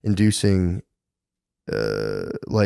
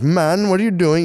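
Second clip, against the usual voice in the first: high mock woman's voice; wife's protest; 10 semitones higher, 3 dB louder than usual. Wife protesting to husband, high mock voice. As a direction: squeak it like the startled wife. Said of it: "She'd be like".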